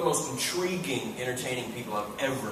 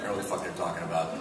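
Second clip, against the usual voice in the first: ordinary talk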